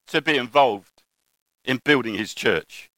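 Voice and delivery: ordinary delivery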